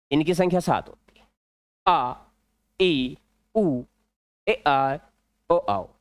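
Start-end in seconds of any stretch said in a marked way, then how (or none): 1.9-5.9 आ ई उ ए आई ओ आओ said one at a time with short pauses; pure vowel pronunciation. Shown as a demonstration. Teacher repeating the pure vowel sounds for students. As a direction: sound out आ, ई, उ, ए, आई, ओ, आओ for dictation. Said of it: "ये इसका शुद्ध उच्चारण है".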